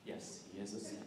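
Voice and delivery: ordinary talk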